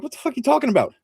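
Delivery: what the hell, sky-high and baffled; incredulous. Retold or imitated. Joe retorts to his brother's rules complaint, incredulous. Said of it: "And I'm like".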